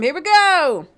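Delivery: normal talking voice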